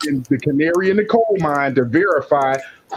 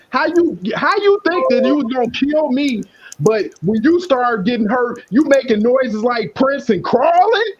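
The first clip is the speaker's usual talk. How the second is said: higher, scornful mocking of the attacker; mocking taunt; 6 semitones higher than usual. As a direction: sneer at him for crawling.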